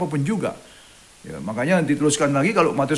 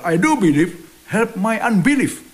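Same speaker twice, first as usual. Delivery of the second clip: pleading exclamation; 7 semitones higher, 4 dB louder, slower than usual. Retold or imitated. Father's plea, Bible quote.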